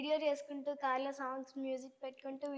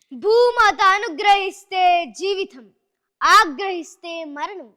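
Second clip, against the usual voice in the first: loud, slow, weighty declamation; Prakash Raj dialogue; 4 semitones higher, 17 dB louder, slower than usual. Acted. Child actor reciting the farmer line, loud and slow. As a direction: intone it like a solemn proverb. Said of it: "Prakash Raj గారిది dialogue".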